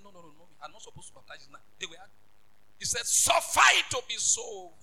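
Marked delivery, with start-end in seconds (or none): 0.0-1.6 very soft, low, quick protest; hushed hurried refusal. Acted. John protesting to Jesus, hushed and hurried. 3.1-4.7 high, drawn-out acted command; slow, raised-pitch insistence; insistent Jesus. Jesus urging a reluctant John, slow and insistent.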